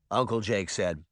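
ordinary delivery, nothing marked

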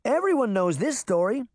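put-on high, incredulous little girl; exclaiming. A narrator's reading. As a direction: insist on it in disbelief. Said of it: "Daphne exclaimed".

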